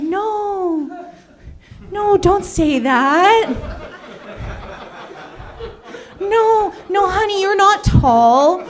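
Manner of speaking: high, loud, slow consoling coo; hammed-up gushing friend. Friend fussing over a tall person, high slow cooing. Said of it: "have they been like".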